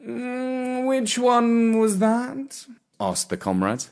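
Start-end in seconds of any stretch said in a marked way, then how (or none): 0.0-2.7 very slow, lazy aristocratic drawl; unhurried, lordly and bored; snooty bored cat. Acted. Gatsby answers.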